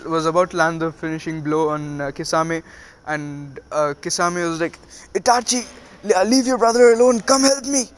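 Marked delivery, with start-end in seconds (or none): 5.2-7.9 very high, loud frantic plea; desperate shout. Acted. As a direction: scream for help as the blow comes. Kisame desperately calling Itachi.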